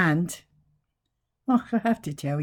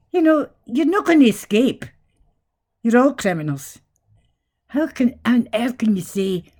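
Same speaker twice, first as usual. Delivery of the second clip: angry rant; 5 semitones higher, 5 dB louder than usual. Real, not acted. Ranting at politicians.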